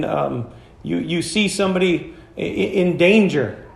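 normal talking voice

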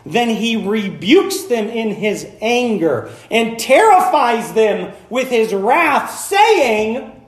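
forceful, drawn-out angry proclamation; wrathful tone. Acted. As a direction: thunder it out word by word. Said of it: "God's gonna give you his tone".

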